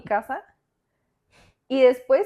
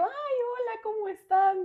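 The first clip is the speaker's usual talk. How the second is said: much higher, sugary innocent hello; innocent greeting; 12 semitones higher, slower than usual. Retold or imitated. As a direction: play it sweet like nothing happened. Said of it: "y yo". (saludo inocente, muy agudo)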